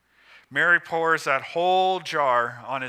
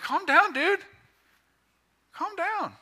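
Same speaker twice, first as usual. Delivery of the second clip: high-pitched mock telling-off; protesting bystanders; 14 semitones higher than usual. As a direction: rein in an overexcited friend, voice pitched way up. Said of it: "everyone around him's like".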